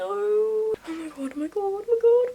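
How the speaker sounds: panicked high-pitched squealing; scared. Real, not acted. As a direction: shriek it out in real fright of a spider. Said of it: "I'm really scared".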